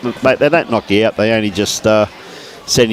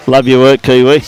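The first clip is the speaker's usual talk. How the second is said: louder, hearty praise called across the green; 2 semitones higher, 7 dB louder than usual. Real, not acted. Commentator calls loud praise across the green to Kiwi. Calling out to teammate.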